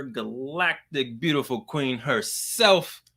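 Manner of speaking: drawn-out showman's welcome; hype guest introduction. Acted. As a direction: introduce her like a champion entering the ring.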